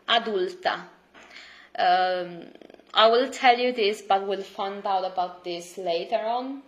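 ordinary talk, nothing marked

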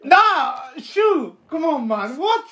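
high-pitched groaning disbelief; exasperated protest. Real, not acted. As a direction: protest the awful pun in a high voice. Groaning at a bad pun.

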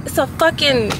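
startled and freaked out; alarmed. Real, not acted. Bug found in her car.

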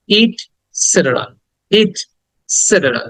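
slow "ইটসেটেরা", split after "it"; correct pronunciation. Shown as a demonstration. Teacher drills students, slow split "ইটসেটেরা". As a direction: pause between "it" and "setera" in "ইটসেটেরা". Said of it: "প্রকৃত উচ্চারণ হবে".

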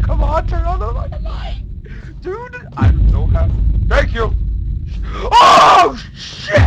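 very high, loud, frantic cries; panicked shrieking. Real, not acted. Gamer fumbling for the flashlight, shrieking in panic. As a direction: voice cracking in terror.

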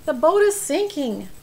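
loud, high cry of warning; urgent storybook shout; shouted alarm. A narrator's reading. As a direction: shout the alarm to a sleeping friend. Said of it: "his friend shouted".

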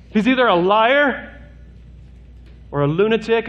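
high, urgent sermon climax; fiery preaching. Acted. Preaching at full force.

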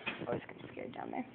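scared whisper. Real, not acted. Scared in basement.

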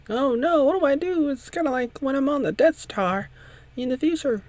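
very high, squeaky mock distress; panicky character voice. Character frets like on the Death Star, panicky falsetto.

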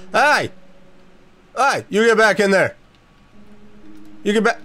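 calling out sharply across the field; yelling at cows. Real, not acted. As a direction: shout the cows back in.

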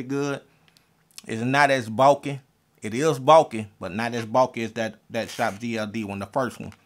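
speaking over metal tooth cover; wearing a grill on teeth. Real, not acted. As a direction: lips around bulky grill. Seen 1: Metal grill visibly covers his upper and lower teeth while speaking.

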